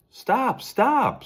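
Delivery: high, loud call from a distance; loud high cry of stop; yelling. Acted. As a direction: yell after them to halt. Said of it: "someone yelled".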